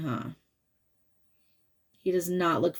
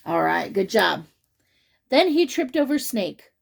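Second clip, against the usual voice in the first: usual speaking voice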